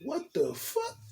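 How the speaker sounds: a high, startled "what the"; mock stunned outburst; shocked disbelief. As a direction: retell the moment you saw him jump.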